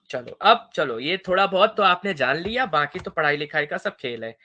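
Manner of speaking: usual speaking voice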